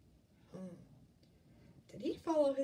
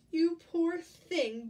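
loud, slow, raised giantess voice; booming giantess; 6 semitones higher, 8 dB louder, slower than usual. A narrator's reading. Giantess pities hungry Jack, booming slowly. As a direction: boom it out slowly with pity. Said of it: "boomed the giantess".

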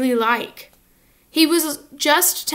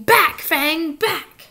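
Hagrid calling off dog; 5 semitones higher, 3 dB louder, much slower than usual. A narrator's reading. Hagrid shouts at his dog.

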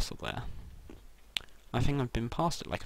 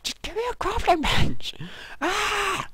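much higher, loud exasperated yell; frustrated scream; 6 dB louder than usual. Real, not acted.

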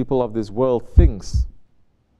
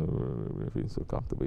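breathy, hushed, smug self-comfort; mock contented whisper; 11 semitones lower, 11 dB quieter than usual. Preacher mocks a navel gazer with a smug whisper. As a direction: whisper it like someone lost admiring their own belly.

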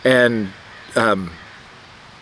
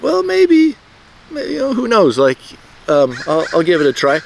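hopeful shrug; 6 semitones higher than usual. Retold or imitated. Hopeful thought about tryouts.

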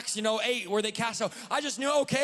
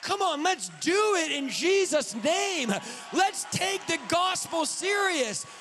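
preaching shout; 6 semitones higher, slower than usual. Real, not acted. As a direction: call the church to take it seriously. Preacher exhorting congregation.

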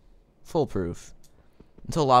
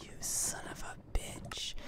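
slow, voiceless whisper through frustration; whispered curse; 16 dB quieter, slower than usual. Real, not acted. Defeated player whispering at the skeleton, bitter. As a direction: breathe the insult under your breath. Muttering after dying.

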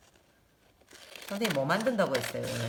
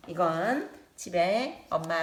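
usual speaking voice

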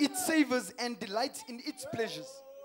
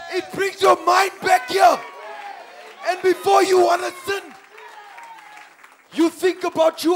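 loud, insistent pulpit proclamation; full-force preaching; 5 semitones higher, 10 dB louder than usual. Acted. Preacher defending the amen corner to believers, full-force preaching. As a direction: drive each phrase home to the congregation.

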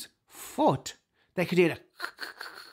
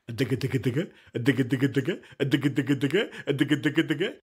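heartbeat imitation; 2 semitones lower, much faster than usual.

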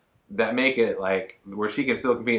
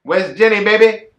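playful hype; 8 semitones higher, 10 dB louder than usual. Pretend. Jokey baby name riff.

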